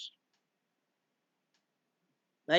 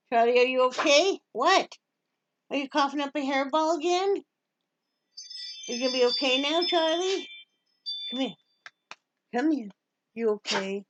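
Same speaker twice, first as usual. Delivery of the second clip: pet talk; 7 semitones higher than usual. Real, not acted. Cooing to her pet dog.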